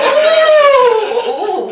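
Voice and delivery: a high, smug "ooh" of self-praise; high proud squeal. Acted. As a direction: squeal with pride. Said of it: "self-congratulating squeal".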